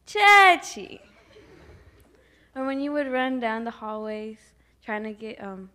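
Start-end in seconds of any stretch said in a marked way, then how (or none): 0.1-1.0 loud high cry of a name; calling out loudly. Retold or imitated. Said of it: "Linda would cry".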